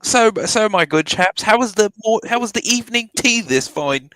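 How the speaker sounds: put-on British accent. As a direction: play the genteel British tea-drinker. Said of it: "have a conversation in British accent".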